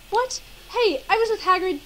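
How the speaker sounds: high, loud defensive retort; hammed-up offended objection; acted indignant protest. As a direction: protest the accusation, pitch jumping up. Harry protests Malfoy's accusation.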